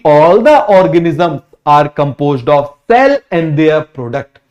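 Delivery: very slow, each word drawn out; slow classroom dictation of a definition. Shown as a demonstration. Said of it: "इन दोनों ने मिलके क्या बोला".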